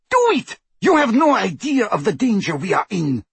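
frantic high-pitched shriek. A narrator's reading. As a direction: shriek the order, desperate. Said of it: "shrieked Bellatrix".

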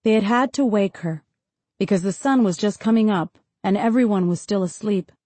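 usual speaking voice

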